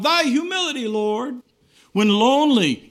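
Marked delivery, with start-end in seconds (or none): 0.0-1.4 higher pleading cry to the Lord; fervent cry. Retold or imitated. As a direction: reach up high on the plea. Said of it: "you can cry out".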